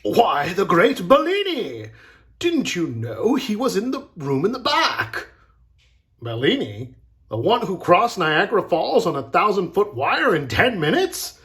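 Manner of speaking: high, loud showman's enthusiasm; excited boasting. A narrator's reading. Agent and mime talk of the great Bellini, excited. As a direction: marvel loudly at a famous name.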